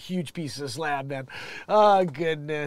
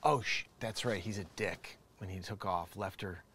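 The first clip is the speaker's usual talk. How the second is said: ordinary talk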